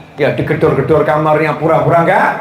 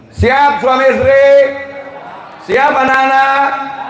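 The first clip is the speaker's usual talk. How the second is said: high, drawn-out call to a crowd; ringing, slow preacher's challenge; rallying call; 10 semitones higher, slower than usual. Real, not acted. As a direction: call out for an answer, holding the last word. Calling the audience to respond.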